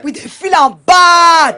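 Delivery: loud, slow fan's shout; excited shout. Acted. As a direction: draw it out with fan delight. Fan praising star.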